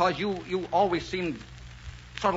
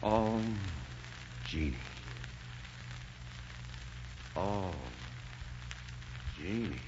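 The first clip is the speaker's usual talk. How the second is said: lower, much softer, a breath of her name; tender murmur; 7 semitones lower, 13 dB quieter, slower than usual. Acted. Overcome lover murmurs her name.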